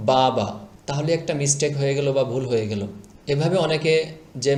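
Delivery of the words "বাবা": first vowel of বাবা drawn out long; wrong stretched বাবা. Shown as a demonstration. Teacher imitates learners' mistake, stretching বাবা. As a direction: drag the opening বা of বাবা. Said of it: "টান দিয়ে ফেলেন বা লম্বা করে".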